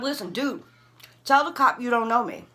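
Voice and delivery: high, loud, urgent insistence; acting out frantic exasperation; exasperated demand. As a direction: demand that someone clear your name. Acted urgent demand to friend.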